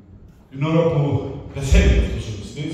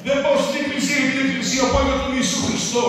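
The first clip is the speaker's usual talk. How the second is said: louder, higher preaching push; a preacher drives his point home to the church, forceful preaching; 10 semitones higher, 5 dB louder than usual. Real, not acted. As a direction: preach it to the congregation. Preacher builds to full force.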